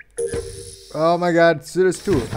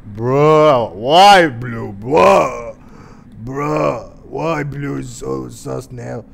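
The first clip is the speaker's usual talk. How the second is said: indignant; 7 semitones lower, slower than usual. Acted. Indignant protest, deeper voice.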